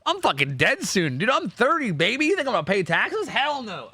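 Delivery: high, cocky and dismissive; defiant, carefree mock rant. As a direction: brush off the taxman like a rebel. Mock defiance toward taxes.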